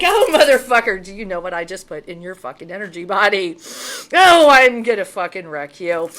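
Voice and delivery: loud, furious threat shouted at a cheat; furious yell. Retold or imitated. Ellen yelling at the warlock she has exposed, furious. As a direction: let rip, yelling he's done for.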